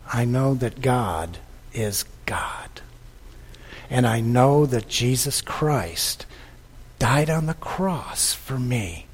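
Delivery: soft, slow, whispered creed; hushed, slow personal prayer; whispered confession. Acted. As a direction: confess it quietly to yourself. Preacher voices believer's confession.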